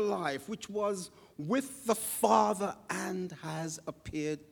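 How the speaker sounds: slow, reverent reading of scripture. Acted. Preacher reading John's words to the congregation, slow and reverent. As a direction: read it slowly, like holy text.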